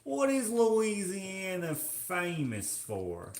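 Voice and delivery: slow, high put-on Louisiana drawl; hammed-up Louisiana accent. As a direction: stretch it out in a mock Louisiana twang. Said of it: "Oh, the accent".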